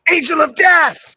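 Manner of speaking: loud hammed-up band intro; shouted announcement. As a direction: yell it like a metal frontman.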